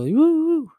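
high, loud mock "woo"; imitated crowd whoop. Some Latinos in the house answering, high imitated whoops. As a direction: whoop like a few audience members. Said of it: "Some people".

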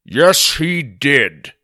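very loud, drawn-out boom. Acted. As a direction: boom it out from the darkness. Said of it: "came a booming voice".

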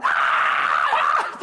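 long imitated scream; mock scream. Boy shoved down the hole, screaming. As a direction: scream like the boy being stuffed down. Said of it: "He's going".

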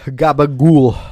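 hammed-up Jersey Italian accent, loud; mock Jersey mobster accent; Sopranos Italian-American. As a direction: put on a Sopranos-style Italian-American drawl. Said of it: "like watching an episode of Sopranos".

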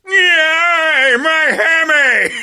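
hammed-up scream of a pulled hamstring; mock scream. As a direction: yell it out in pain, hammed up. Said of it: "he just screams it at the top of his lungs".

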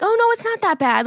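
high, dismissive inner voice; brushing it off to yourself; self-reassuring inner voice. Retold or imitated. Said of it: "you're like".

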